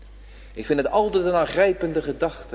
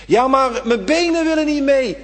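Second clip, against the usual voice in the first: high, loud protesting voice; imagined churchgoer protesting to the preacher, high and loud; objecting listener; 10 semitones higher, 8 dB louder than usual. Acted. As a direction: whine back like a doubter.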